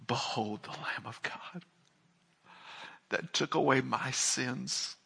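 softly, reverently, as if standing in heaven; a hushed personal confession of wonder; awed hush. Pretend. Believer in heaven beholding the Lamb, hushed reverence. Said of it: "and we're gonna say".

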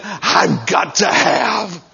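drawn-out, hammed-up desperate demand; urgent craving. Pregnant wife demanding food from her husband, hammed-up craving. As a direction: stretch each word out slowly, desperately.

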